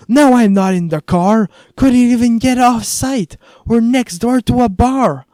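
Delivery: high, loud yell down the phone; shouting. A narrator's reading. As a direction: shout over blaring bar noise. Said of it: "to match her volume".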